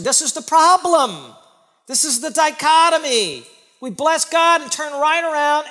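loud, high preacher's cry; booming sermon emphasis; preaching at full force. Acted. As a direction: drive it home from the pulpit. Preaching peak.

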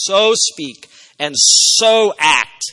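both "so"s drawn out and loud; slow, punched "so", pause, then "so" punched to match; emphatic repetition. Shown as a demonstration. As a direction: lean hard and slowly on each "so" in "So speak and so act". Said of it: "is repeated for emphasis".

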